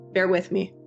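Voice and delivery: usual speaking voice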